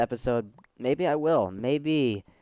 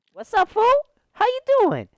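very high, loud squeaky falsetto; silly greeting; 7 dB louder than usual. Pretend. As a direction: call out in a comic falsetto.

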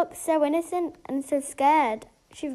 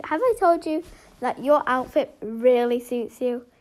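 ordinary talk

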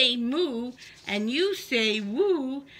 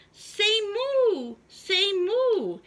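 coaxing cow voice; 8 semitones higher, 3 dB louder, slower than usual. Acted. Cow urging each animal.

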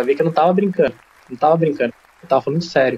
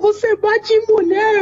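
high, mocking falsetto of the outraged girlfriend; slower than usual. Boyfriend mocks girlfriend accusing him of hitting, high shrill falsetto. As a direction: squeal her accusation much higher and slower.

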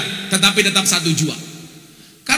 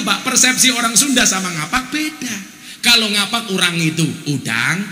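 ordinary delivery

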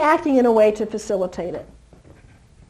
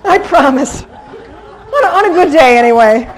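laughing hard, higher; 5 semitones higher, 6 dB louder than usual. Real, not acted. Trainer laughing after checking the puppy's sex. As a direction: laugh through the words, embarrassed.

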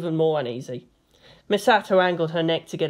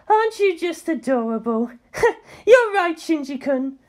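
higher, laughing fondly; laughing affectionate coo; 11 semitones higher than usual. A narrator's reading. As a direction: coo at him through laughter. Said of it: "She had to laugh".

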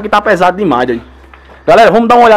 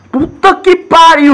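high, slow, drawn-out curse; real awe at something sinister; shocked swear; 10 semitones higher, 3 dB louder, much slower than usual. Real, not acted. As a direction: drag it out in amazement. Shocked by eerie room.

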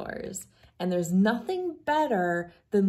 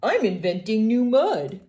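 a touch of sass, a bit louder; sassy; 3 semitones higher, 7 dB louder than usual. Acted. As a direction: toss it off like a cheeky kid. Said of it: "Does she sassily say".

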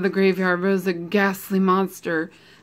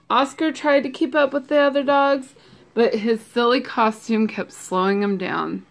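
plain reading voice

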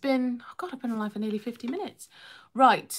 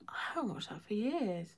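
almost silent whisper, much softer; barely audible fond whisper; fainter whisper; 9 dB quieter than usual. Real, not acted. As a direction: let it fade to a breath. Repeats it even more hushed.

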